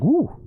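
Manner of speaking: high excited woo; delighted woo; high whoop. Real, not acted. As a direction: whoop after the sip. Whoop after pickle juice.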